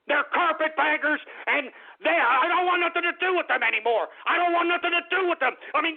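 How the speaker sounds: high-pitched, worked-up tirade; fed-up, escalating outrage; angry rant. Real, not acted. Ranting against newcomers ruining Austin.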